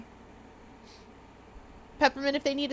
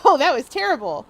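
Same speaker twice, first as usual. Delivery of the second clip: louder disgust; 4 semitones higher, 8 dB louder than usual. Retold or imitated. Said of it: "you drink another and you go".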